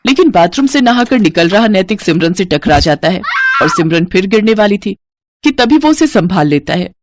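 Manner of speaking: plain reading voice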